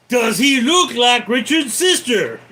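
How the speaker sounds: loud, high interrogation shout; shouted demand. Acted. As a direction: yell the question like an interrogator. Repeats film line, shouting.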